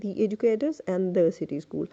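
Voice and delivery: "thee" educators, "thuh" city school; both article sounds. Shown as a demonstration. Teacher sums up the rule, "thee" then "thuh". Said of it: "So you have to say".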